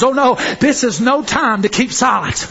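ordinary talk

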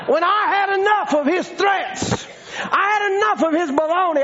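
high, driving sermon shout; rally the congregation with fierce defiance; impassioned preacher hammering out each declaration; full-force preaching. Real, not acted.

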